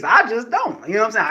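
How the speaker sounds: ordinary talk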